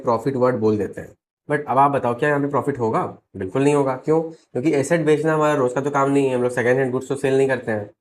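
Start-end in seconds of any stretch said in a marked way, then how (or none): none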